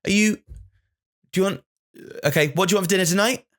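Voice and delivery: put-on high, fast, clear questions to a child; clear kid talk. Shown as a demonstration. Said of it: "clear communication in short sentences".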